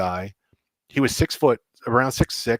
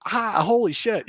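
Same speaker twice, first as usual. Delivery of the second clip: slow, much higher amazed gasp; stunned exclamation; 11 semitones higher, slower than usual. Retold or imitated. Host watching players wreck his gear, slow stunned cry. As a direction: drag it out like you can't believe it.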